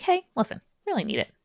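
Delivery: lightly acted gentle plea, starting high; softly coaxing, mock-polite opener; polite request. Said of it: "start with a polite bent".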